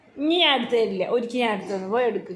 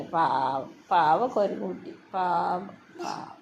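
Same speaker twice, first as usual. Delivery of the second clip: cooing pity; 6 semitones lower, 4 dB quieter, much slower than usual. Pretend. Mother pities child's toy monkey, lower, soft cooing. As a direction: lower and softer, cooing over a poor little toy.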